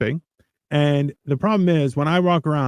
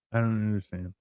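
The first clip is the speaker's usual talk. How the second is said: deadpan incomprehension; 4 semitones lower, 9 dB quieter than usual. Retold or imitated. Acted response to ridiculous talkers.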